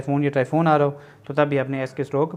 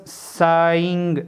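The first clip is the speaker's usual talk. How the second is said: drawn-out vowels; 2 semitones higher, much slower than usual. Shown as a demonstration. Teacher introducing a triphone word, "saying" long and stretched. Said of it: "ये हमारे पास जो है triphone है".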